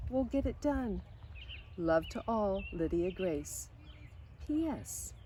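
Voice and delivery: put-on lively storybook reading of a girl's letters; reading to children. A narrator's reading. Reading Lydia Grace's letters home to listening kids, storybook voice. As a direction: read it like a bedtime story, bright and gentle.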